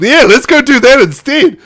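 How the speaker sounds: eager agreement. Retold or imitated. Turlough agreeing to go drinking, eager. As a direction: jump at the idea.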